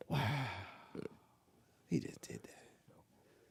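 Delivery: mock-awed whisper, drawn out; hushed amazement. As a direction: whisper it slowly, like a stunned onlooker. Imitating others' hushed awe.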